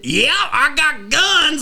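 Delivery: loud, high, stretched-out cowboy Texan drawl; hammed-up Texan whoop, very slow. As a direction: holler it like a caricature Texan. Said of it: "America has three accents".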